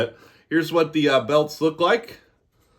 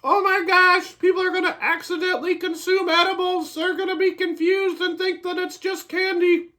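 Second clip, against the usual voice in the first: high mocking voice of panicked critics; squeaky, high mock-panic about edibles; mocking worried people. Mocking critics who fear accidental edibles, high mock panic. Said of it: "all those people that are like".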